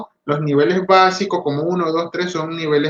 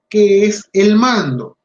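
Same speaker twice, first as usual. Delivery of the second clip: louder, slow question, leaning on mando; emphatic question; 4 semitones higher, 6 dB louder, slower than usual. Shown as a demonstration. Lecturer to audience, slow emphatic question. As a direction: pose it boldly to the room. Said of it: "rhetorical question to audience".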